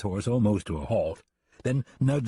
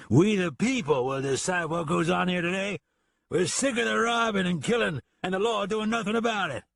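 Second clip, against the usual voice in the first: put-on raised, slurring drunken rant; angry drunken voice; 9 semitones higher than usual. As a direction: rile up the crowd. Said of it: "in an angry, drunken voice".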